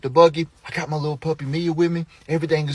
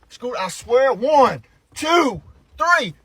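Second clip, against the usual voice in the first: put-on high, drawn count to a child; exasperated parent counting; warning countdown; 8 semitones higher, much slower than usual. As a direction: count out a threat, pitch jumping up.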